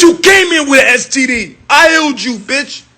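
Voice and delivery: ordinary talk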